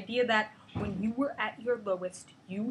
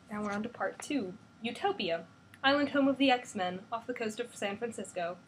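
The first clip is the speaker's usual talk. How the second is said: usual speaking voice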